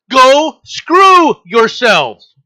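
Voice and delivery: slow, loud furious dismissal; real anger, drawn out and loud. Real, not acted. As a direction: bellow it slowly with contempt. Angry rant at Europeans.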